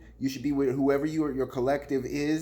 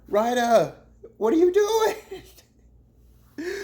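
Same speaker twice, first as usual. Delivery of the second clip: very high, loud and very slow, laughing through "what are you doing?"; stretched-out disbelief; 7 dB louder, much slower than usual. Acted. Vlogger calling out Ryder a second time, stretched, laughing shout. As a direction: drag every word out like you can't believe it. Scolding Ryder again, drawn out.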